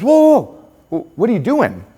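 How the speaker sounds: high, loud "whoa" easing off into the question; alarmed teacher. Retold or imitated. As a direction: imitate a teacher halting a kid mid-action. Teacher abruptly stops the peeling kid.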